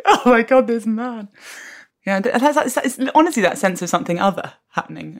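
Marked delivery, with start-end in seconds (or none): 0.1-1.3 mock swoon. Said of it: "you're just like".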